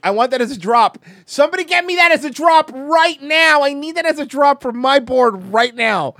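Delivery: loud, high, insistent shout; urgent yell, stretching the key words; excited shouted demand. Acted. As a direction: demand it at the top of your voice. Excited demand for a sound drop.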